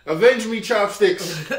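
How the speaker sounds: hammed-up high, loud dying plea; overacted death scene. Joking about being killed off.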